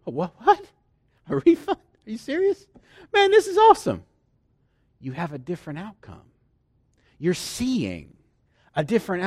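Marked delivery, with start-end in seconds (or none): 0.1-4.0 high, loud, bursting with disbelieving joy; mock delighted surprise. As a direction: act out hearing great news you can't believe. Mock reaction to getting a refund.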